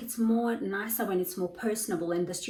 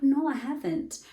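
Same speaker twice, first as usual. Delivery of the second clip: high, slow, louder no; bright reply; 7 semitones higher, 4 dB louder, slower than usual. Retold or imitated. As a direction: answer cheerfully. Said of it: "I'm like".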